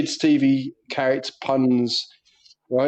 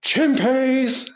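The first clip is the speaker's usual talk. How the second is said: high-pitched imitated yell, no real volume; mock group shout; 8 semitones higher than usual. Schoolboys shouting nonsense to wind up their teacher, mock shout. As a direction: call it out high, like rowdy schoolkids. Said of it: "all the lads on the bus just shouted out".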